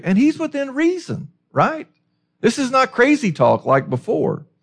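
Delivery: high-pitched disbelief; incredulous. Acted. Preacher to congregation, pleading that it's reasonable, incredulous. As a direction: ask it as if the objection is absurd.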